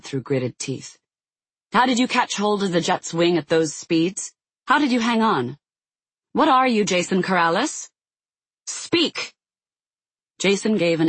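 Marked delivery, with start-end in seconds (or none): 1.7-9.3 interrogating. Acted. Interrogating him under the lasso.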